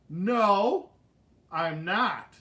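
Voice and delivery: loud, emphatic retold refusal; defiant refusal. Ten-year-old refusing his family the roller coaster, defiant. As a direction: imitate a stubborn kid digging in.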